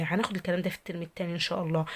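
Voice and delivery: ordinary delivery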